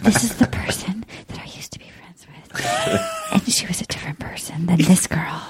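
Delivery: breathy mock whisper; hushed imitation of a confession; imitated whispering. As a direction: whisper it, too faint to catch. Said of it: "Hannah B is just whispering".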